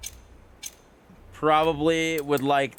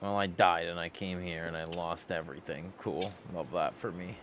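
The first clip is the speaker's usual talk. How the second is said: low, soft, dry sarcasm; deadpan sarcastic; 6 semitones lower, 6 dB quieter than usual. Real, not acted. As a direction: drily shrug off the loss. Lost everything after dying.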